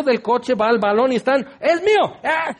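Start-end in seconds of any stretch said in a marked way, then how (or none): 1.6-2.6 put-on high, excited child's cry; excited kid. Preacher imitates street kids fighting for the ball, high excited cry. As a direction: grab the ball and claim it.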